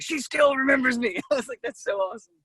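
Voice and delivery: high-pitched retold amazement; mind-blown. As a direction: squeal it lightly, thrilled to be remembered. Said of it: "it, like, blew my mind".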